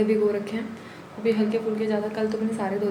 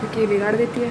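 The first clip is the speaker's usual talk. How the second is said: ordinary delivery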